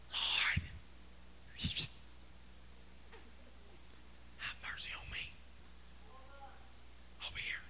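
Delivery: barely audible, halting whisper; timid whisper. Acted. Lepers too timid to holler at Jesus, timid whisper. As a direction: whisper it as if afraid to be heard. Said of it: "I don't believe that those guys said".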